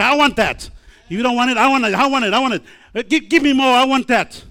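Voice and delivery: loud, high, insistent craving; hungry eager shout. Acted. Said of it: "Be like Elijah and Elisha".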